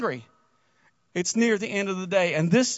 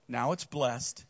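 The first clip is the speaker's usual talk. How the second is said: quiet solemn command; 10 semitones lower, 9 dB quieter, much slower than usual. Retold or imitated. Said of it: "he says these words".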